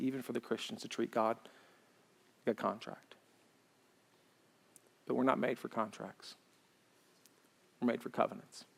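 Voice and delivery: soft, quiet, intimate sermon voice; hushed, tender sermon delivery; gentle pastoral preaching. Acted. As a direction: lean in and speak softly to the hurting.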